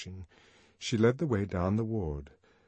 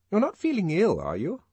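concerned nurse; 11 semitones higher, 6 dB louder than usual. Acted. Nurse asks if he's ill.

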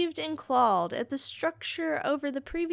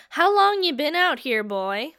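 barked question, louder; loud gruff question; 4 semitones higher, 8 dB louder than usual. Acted. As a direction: demand it roughly. Gruff captor questions prisoner.

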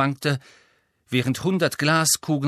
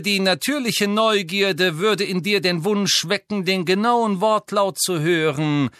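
high, slow, sneering aristocrat; Malfoy voice; 8 semitones higher, 4 dB louder than usual. Acted. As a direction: taunt him slowly from above. Malfoy taunts Harry.

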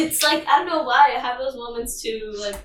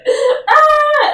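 high, loud wailing cry; 10 dB louder than usual. Retold or imitated. Little sister hit in the face with a toy, loud high wail. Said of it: "she was crying, like".

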